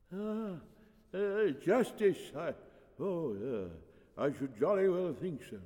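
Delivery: put-on doddering old priest. Old priest answering a plea, doddering. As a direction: gather your wits haltingly.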